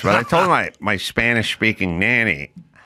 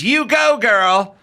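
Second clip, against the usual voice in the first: put-on high, loud "you go girl" cheer; mock sassy cheer; 11 semitones higher, 3 dB louder, slower than usual. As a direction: cheer her on like a hype friend. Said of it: "the phrase".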